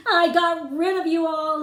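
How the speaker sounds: high, loud triumphant crow; gleeful Pharaoh shouting high and loud in triumph; gleeful cry. Acted. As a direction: crow it out like a king who has won. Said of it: "he gleefully cried".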